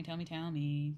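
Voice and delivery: usual speaking voice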